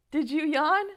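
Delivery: normal talking voice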